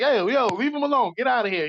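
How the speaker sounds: lightly acted call to leave the kid alone; stranger shooing bullies. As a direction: shout the boys away from the kid.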